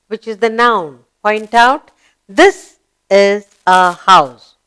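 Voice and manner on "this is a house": loud, slow, separated words; slow model reading. Shown as a demonstration. Teacher reading the house sentence to pupils, slow model reading. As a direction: read it out firmly, word by word.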